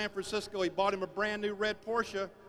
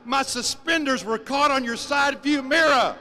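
louder, blurt it out as the farmer; the punchline hollered out; farmer's loud reveal; 3 semitones higher, 9 dB louder than usual. Acted. Farmer tells the Porsche driver why, loudly.

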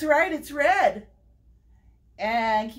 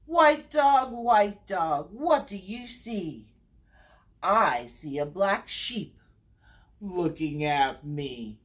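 sing-song read-aloud, each repeated name stepping down; story time refrain with falling line ends; picture-book reading; 4 semitones lower, slower than usual. Acted. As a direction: read to little ones with lilting, measured phrasing. Reads picture book to children.

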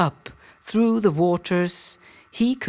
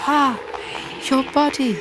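high echo of her moan, less forceful; 6 semitones higher, faster than usual. A narrator's reading. Two creatures echo the queen's pain into her ears, high moaning echo. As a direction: mirror her pain back to her. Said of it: "echoed her words back into her ears".

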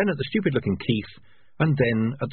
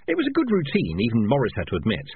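ordinary delivery, nothing marked

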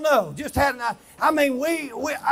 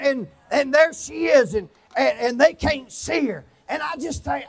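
normal talking voice

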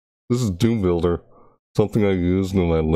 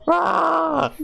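very high sustained "ah"; high scream. Pretend. Streamer to viewers while waiting, high playful scream. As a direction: let out a high-pitched scream.

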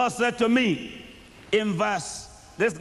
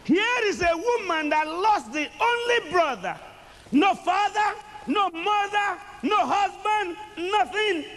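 preaching at full force; 6 semitones higher than usual. Real, not acted. Preacher lifting the church with her loss. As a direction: build it up, pitch soaring, over the crowd.

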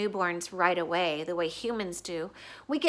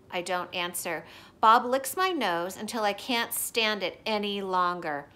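ordinary delivery

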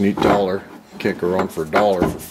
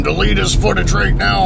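higher-pitched mock yell; retold yelling; 8 semitones higher than usual. Retelling Best Buy guy's demand to Tom in a mock yell. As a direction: imitate someone yelling an order, higher than usual. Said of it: "started yelling at him".

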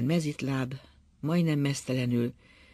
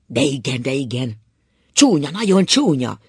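shouting; 10 dB louder than usual. A narrator's reading. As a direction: shout it out, worked up. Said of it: "Kiáltott Ákos kijelegve". (kiáltva, felindultan)

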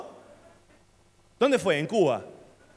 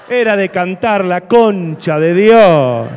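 grito exasperado; 15 dB louder, much slower than usual. Real, not acted. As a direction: shout it out slow and furious. Se queja gritando del musical. (grito lento y furioso)

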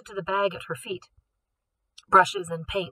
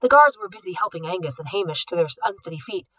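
usual speaking voice

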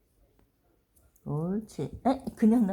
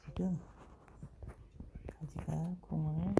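whispering; 4 semitones lower, 9 dB quieter than usual. Real, not acted. Whispering while dog works.

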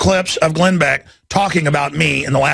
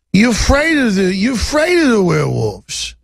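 raised, hammed-up Cockney; Oliver Twist Cockney; 3 semitones higher than usual. Interviewer teasing his guest about werewolves, hammed-up Cockney. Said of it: "that Oliver Twist Cockney accent".

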